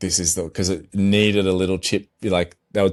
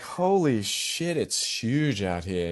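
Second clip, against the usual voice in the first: soft, slow, stunned exclamation; retold awe; 6 dB quieter, slower than usual. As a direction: let it out slowly, under your breath, amazed. Retold awe at big surf.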